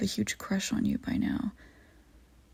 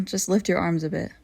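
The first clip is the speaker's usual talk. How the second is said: ordinary talk, nothing marked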